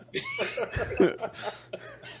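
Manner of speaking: high-pitched laughter; high laughing fit. Real, not acted. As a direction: crack up laughing. Laughing hard.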